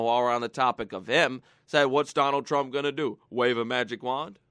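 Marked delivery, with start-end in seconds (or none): 1.9-4.4 low, quiet put-on Obama voice; mock Obama. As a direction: imitate him with a sneer, low and soft. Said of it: "Barack Hussein Obama, said".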